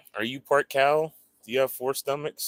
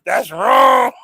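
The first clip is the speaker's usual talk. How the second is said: loud, emphatic protest; loud insistence; 10 semitones higher, 16 dB louder than usual. Real, not acted. Streamer insisting to chat, loud and emphatic. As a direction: insist loudly it's wrong.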